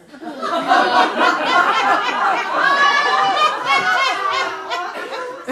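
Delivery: loud, high, drawn-out cackle; a long, manic, unhinged cackle. Acted. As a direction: laugh like you're cracking up. Laughing at near psychotic break.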